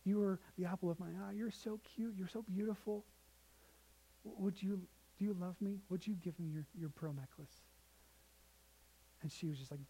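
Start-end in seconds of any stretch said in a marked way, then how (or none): 0.0-7.5 soft, tender coaxing from a father; hushed, loving plea with hesitant pauses; pleading dad. Retold or imitated. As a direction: ask gently, as if kneeling to a child. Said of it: "got down on one knee".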